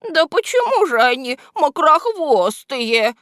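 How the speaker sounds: high, loud little henchman voice; timidly daring objection. A narrator's reading. Henchman venturing to contradict his boss, high protesting voice. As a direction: dare to object in a high-pitched voice. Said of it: "осмелился возразить ему вреднюга".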